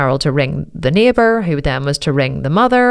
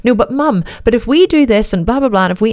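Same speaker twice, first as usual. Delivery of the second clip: higher, rushed explaining; pleading; 6 semitones higher than usual. Retold or imitated. Daughter rushing to explain to her mother, higher. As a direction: talk over Mom to explain.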